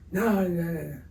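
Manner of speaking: imitating the threatening caller's sharp denial; threatening retold. Yankees rep cutting off the artist, mock angry. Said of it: "he literally threatened me".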